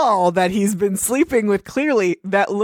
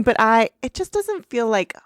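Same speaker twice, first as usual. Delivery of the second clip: ordinary talk, nothing marked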